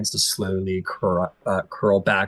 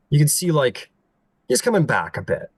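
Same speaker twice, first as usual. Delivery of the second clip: ordinary talk, nothing marked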